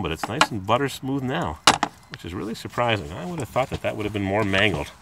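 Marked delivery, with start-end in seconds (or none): none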